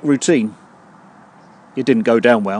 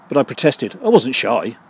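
ordinary talk

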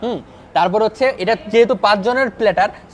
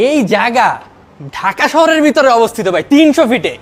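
louder, higher hyped presenter pitch, slowing on the last line; excited announcing; 4 semitones higher, 7 dB louder than usual. Real, not acted. Vlogger hyping the Mughal restaurant to viewers, excited announcing. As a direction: sell the place to the camera with big energy.

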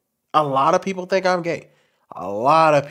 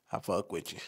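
much lower, softer mock tough-guy voice; hardened dude; 8 semitones lower, 14 dB quieter than usual. Mocking the hardened dude he's not, low tough-guy mumble. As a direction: sink the voice low like a hard street dude. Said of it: "I'm not some hardened dude like".

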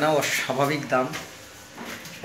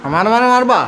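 mock outrage; 8 semitones higher, 7 dB louder than usual.

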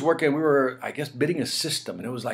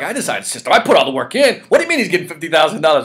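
louder indignant complaint; retold anger; 4 semitones higher, 7 dB louder, faster than usual. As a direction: let the outrage build. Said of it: "I started getting mad".

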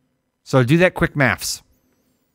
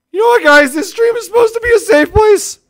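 very high, loud indignant yell; mock tantrum; 7 dB louder than usual. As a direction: shriek at the chat in hammed-up outrage.

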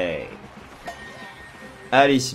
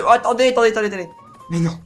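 high, urgent wait wait; panicked high-pitched stop; alarmed; 9 semitones higher, 5 dB louder, much faster than usual. Real, not acted. Sudden danger in game.

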